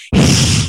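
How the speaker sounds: short, unvoiced mock hiss; imitated hiss. Small boy hissing at a man at a funeral, imitated hiss. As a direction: hiss like a child playing animal. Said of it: "He started hissing at everyone".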